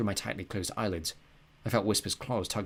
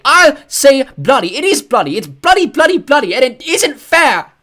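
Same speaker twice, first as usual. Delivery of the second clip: screaming, much higher and louder, slow; defiant yelling; 19 dB louder, slower than usual. A narrator's reading. Boy defying his father, screaming. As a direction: hurl the word at him.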